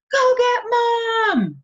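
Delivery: very high slow loud holler; loud high desperate holler. Retold or imitated. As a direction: yell for help as if hurt. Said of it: "Stacy hollered at me".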